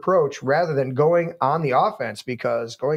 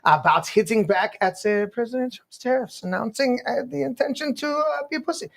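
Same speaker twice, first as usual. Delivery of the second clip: mock German accent; 7 semitones higher than usual. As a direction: read the news in a hammed-up German accent. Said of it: "accent do we read it in?".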